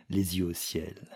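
ordinary delivery

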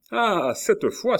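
very high, drawn-out, loud exclamation; triumphant cry. A narrator's reading. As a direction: crow it slowly in triumph. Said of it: "s'écria-t-il". (cri de triomphe aigu et traînant)

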